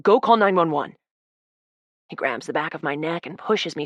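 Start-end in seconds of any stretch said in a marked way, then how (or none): 0.0-1.0 urgent order. Acted.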